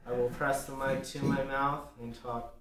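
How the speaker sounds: lips on the mic, soft and close; hushed, mouth pressed to mic. Shown as a demonstration. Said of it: "slowly and softly".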